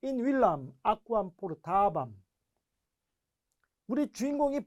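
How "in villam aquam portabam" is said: Latin sentence read out very slowly. Shown as a demonstration. Teacher reads the Latin example aloud very slowly. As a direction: read In villam aquam portabam word by word. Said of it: "예문을 통해서 한번 보죠".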